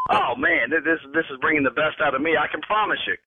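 ordinary talk

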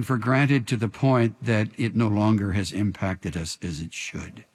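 hushed pastoral prayer; closing prayer. Acted.

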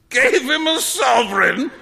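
loud, high incredulous echo; hooting disbelief; amused shout. A narrator's reading. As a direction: shout it back, about to roar with laughter. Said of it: "shouted Trevor".

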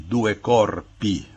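due corpi slowly, with clear final I; Italian plural ending. Shown as a demonstration. Professor gives a plural example, Italian plural ending. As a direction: spell out by ear: due corpi, slow. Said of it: "o plural é feito com I".

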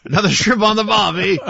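loud, high, hammed-up Australian; cartoonish Australian accent, played for laughs; mock Australian accent. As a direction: overdo the Aussie cliché for a laugh. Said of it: "What accent was that?".

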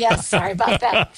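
amused, laugh-filled apology. Real, not acted. Co-host apologizes for the barking dog, laughing.

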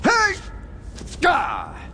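startled yelp in a wrestling hold; pained yelp. Acted. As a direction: yelp as you get grabbed.

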